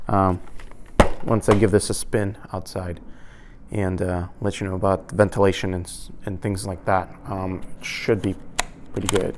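muffled through closed visor; speaking inside a helmet. Real, not acted. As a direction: voice behind helmet shield. Seen 5: Speaker wears full-face helmet with shield down throughout the labelled words.

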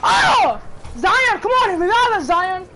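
loud, high gaming outburst; yelling for a teammate to revive; shouting at game. Real, not acted. Gamer shouting at teammate Zion, loud and high.

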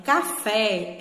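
"café" with a strong, long FÉ; stress on final syllable. Shown as a demonstration. Teacher recalling the example for pupils, FÉ stressed in "café". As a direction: lean on FÉ at the end of "café". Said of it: "a sílaba mais forte que eu pronunciei foi o fé".

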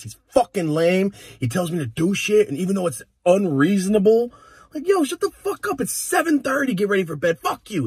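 put-on high, loud kid's rant, building; angry kid rant. Six-year-old ranting about mom's boyfriend, high and loud. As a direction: rant like a furious little boy. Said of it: "I was like".

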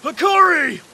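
high, loud battle cry; shouted name. Acted. Fighter calling the opponent's name mid-fight, loud cry. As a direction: bellow the name high and hard.

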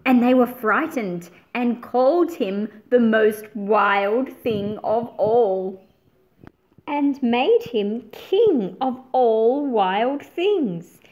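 put-on expressive read-aloud for young listeners; storybook narration. A narrator's reading. Teacher reading the picture book aloud to her class, expressive narration. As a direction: paint the pages with a lively storytelling voice.

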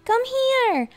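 very high, thrilled shouting; high-pitched excited child; excited yell. A narrator's reading. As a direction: call Mom over, bursting with discovery. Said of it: "I yelled with excitement".

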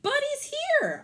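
high, loud happy call; excited shout across the street. Acted. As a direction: call out to the others that the dog is found. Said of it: "I called on to the others".

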